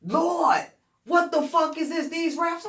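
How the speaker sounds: high, loud astonished exclaiming; amazed. Retold or imitated. Buck amazed at the raps in the notebook, loud and high. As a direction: react like you just found treasure.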